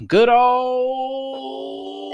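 high, loud, stretched Southern twang; hammed-up Southern holler; Southern accent. Host introducing another Indiana law, Southern accent. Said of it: "I use the same Southern accent for everything".

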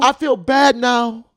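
high, loud, slow comic remorse; imitated apologetic neighbor. Neighbor regretting his outburst, imitated high, loud and slow. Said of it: "if he heard his fucking voice".

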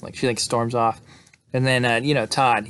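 ordinary talk, nothing marked

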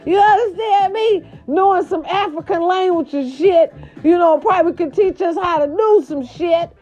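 high-pitched exasperated rant; worked-up, high-voiced telling-off; indignant scolding. Real, not acted. Scolding Oprah over the movie.

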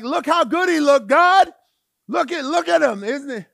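high, loud, eager pointing out; loud eager prophet showing off his pick; excited Samuel. Retold or imitated. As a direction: hype up the tall candidate to God. Samuel voiced pleading to God.

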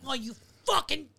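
polite menace exploding into a shout; Joe Pesci pen scene. Retold or imitated. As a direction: Casino pen-stabbing outburst. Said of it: "really great impersonation".

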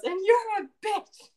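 high, loud jeering insult; booming insult. Retold or imitated. Kirian insulting the prosecution in court, loud and high. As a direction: fling it at the prosecution. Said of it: "points at the prosecution and goes".

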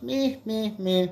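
high nasal-free bleating meh; koala bleat. Acted. Koala answers the prostitute with a high bleat. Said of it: "the koala bear goes".

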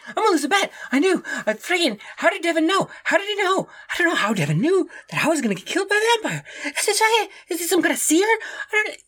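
frantic high-pitched panic, words tumbling out; panicked. Acted. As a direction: babble in terror that the vampire will kill you. Panicked companion fears the vampire.

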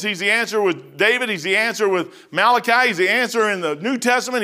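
a preacher climbing to a peak; preaching. Acted. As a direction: hammer each "He's the answer" home. Preaching build-up.

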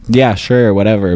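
unimpressed yeah sure whatever; dismissive. Retold or imitated. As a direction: wave it away like it's nothing. Dismissing the It Gets Better videos.

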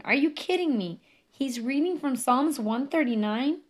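can't believe the coincidence; stunned disbelief. Real, not acted. Realizing he reads her psalm.

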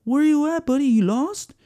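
high coaxing voice for a dog; high friendly voice for a stray dog; talking to a dog. Retold or imitated. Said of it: "I talk to dogs like they're humans".

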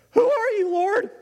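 very high, loud squeaky-voiced question; frightened Saul. Saul to the blinding light, high-pitched and startled. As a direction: blurt it out in a comically high voice.

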